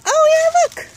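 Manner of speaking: sudden high excited squeal; delighted gasp of discovery; surprised. Real, not acted. Suddenly spots artichoke flower head.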